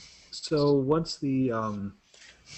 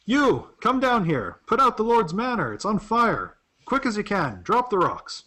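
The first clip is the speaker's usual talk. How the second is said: high, urgent shouted orders; shouting out; 8 semitones higher than usual. Acted. Man at the tower calling workers down, shouting out. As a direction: call up to workers far above. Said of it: "shout out to them".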